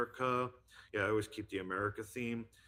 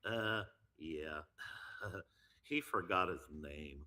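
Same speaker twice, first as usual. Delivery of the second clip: low dopey cartoon teen, chuckling; Beavis and Butthead; 4 semitones lower, 4 dB quieter than usual. Mocking the host to his buddy, low Butthead impression. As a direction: drop low into a slow-witted slacker voice. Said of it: "do it how Beavis and Butthead would".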